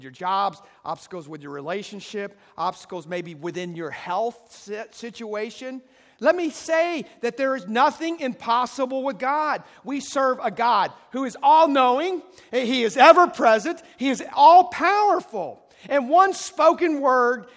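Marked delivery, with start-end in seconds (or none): 6.2-17.5 loud, high, rising proclamation; full-force preacher's cry; preaching at full force. Real, not acted. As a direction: shout the glory of God to the crowd. Preaching climax.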